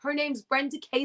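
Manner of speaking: mother bragging to the cab driver; proud mom. Retold or imitated. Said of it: "And my mother was like".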